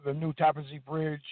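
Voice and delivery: normal talking voice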